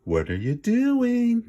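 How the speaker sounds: high, slow, drawn-out tease; mock the wolf with a sing-song question; teasing. A narrator's reading. Kip teasing the sniffing wolf, high and slow. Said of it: "teased Kip".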